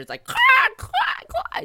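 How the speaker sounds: loud, high mock explosion clacks; cartoon blast effects; explosion noises. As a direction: shout out the crashing sounds. Imitating explosions in the room.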